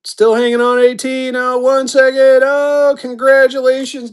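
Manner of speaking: high-pitched auctioneer excitement; real excitement as the bidding closes; excited closing call. Real, not acted.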